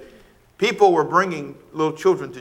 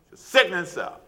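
normal talking voice

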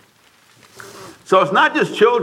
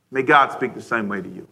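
normal talking voice

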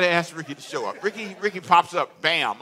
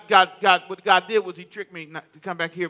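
usual speaking voice

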